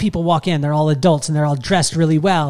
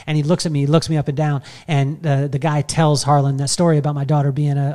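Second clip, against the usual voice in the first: usual speaking voice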